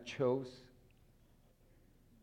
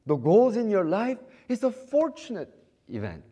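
loud, high, impassioned sermon delivery; full-force preaching; 10 semitones higher, 9 dB louder than usual. Acted. Preacher fired up before the congregation, loud and high. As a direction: preach it out to the whole hall.

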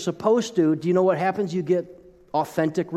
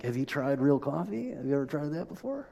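softer, quick mock disbelief; hushed incredulous aside; 8 dB quieter than usual. The pastor scoffing inwardly at a coffee hater, softly. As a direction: mutter it like a private scoff. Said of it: "what are you thinking".

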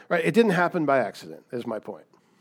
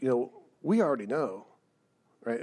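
usual speaking voice

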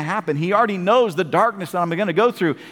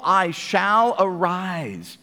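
slow proclamation; much slower than usual. Acted. Scripture line slowed.

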